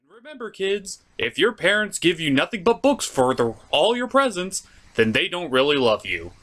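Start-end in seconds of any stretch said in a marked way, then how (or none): none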